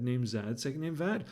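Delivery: plain reading voice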